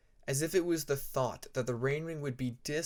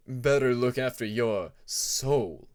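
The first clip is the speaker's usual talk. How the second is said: slow, louder menacing warning; ominous warning; 7 dB louder, slower than usual. Acted. King's veiled threat.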